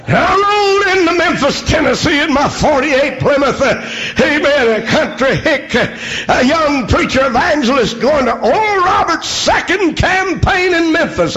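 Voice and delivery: high shouted preaching over music; preaching at full force. Acted. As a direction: lift it into a shouting sermon.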